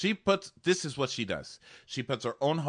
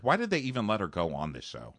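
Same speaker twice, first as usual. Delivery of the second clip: usual speaking voice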